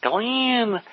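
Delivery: a lightly drawn-out Texas greeting, pitched high; Texas accent. Retold or imitated. Earl calling Glenn, lightly voiced Texas accent. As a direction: call his name with a touch of a Texas twang. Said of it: "He's got this accent".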